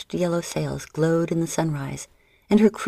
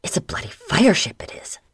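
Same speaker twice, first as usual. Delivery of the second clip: frightened whisper; pirate whispering in horror; gasping in fear; 3 semitones higher than usual. Acted. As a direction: breathe it out in shock. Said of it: "Ginger gasped".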